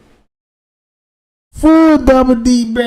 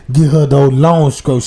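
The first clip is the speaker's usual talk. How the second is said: ordinary talk, nothing marked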